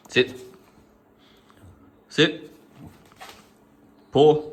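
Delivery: loud training orders to Luna; dog commands. Real, not acted. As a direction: bark the commands at the puppy.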